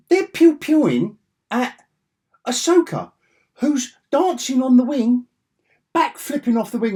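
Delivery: high, loud gleeful telling; excited. Real, not acted. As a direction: rattle it off with glee. Excited retelling of laser fire.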